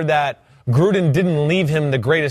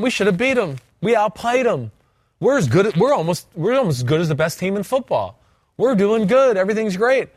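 higher-pitched imitation of cocky players; mock self-assured players; 5 semitones higher than usual. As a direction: voice the team talking itself up, pitched high. Voicing Raiders players after a game.

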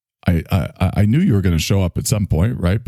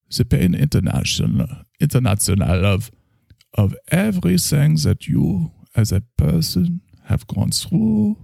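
German doctor voice, higher; German accent; 5 semitones higher than usual. Acted. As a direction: Freudian German therapist accent. German therapist character.

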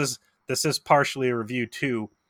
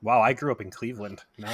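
normal talking voice

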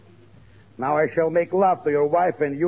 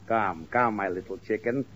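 mock seductive; 6 semitones lower, 6 dB quieter than usual. Pirate tries to woo the wife.

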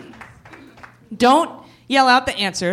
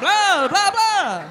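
high-pitched mock vampire blahs; 9 semitones higher, much slower than usual. Host joins the Transylvania joke, mock vampire. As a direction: spoof a cartoon vampire.

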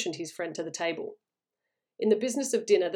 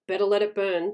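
usual speaking voice

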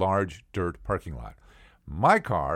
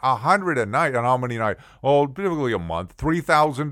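raised, louder, disbelieving questions; incredulous; 5 semitones higher, 6 dB louder than usual. Retold or imitated. Owner balks at the vet's nightly fee, incredulous. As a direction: balk at the price, voice climbing.

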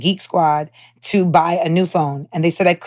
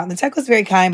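ordinary delivery

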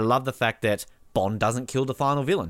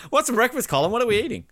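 usual speaking voice

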